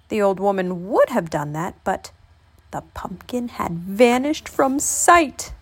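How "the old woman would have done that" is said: usual speaking voice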